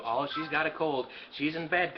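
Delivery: usual speaking voice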